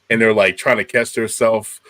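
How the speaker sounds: ordinary talk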